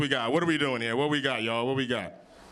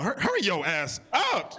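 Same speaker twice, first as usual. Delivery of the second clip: mock angry parent; 5 semitones higher, slower than usual. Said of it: "Just dragging the little kid along".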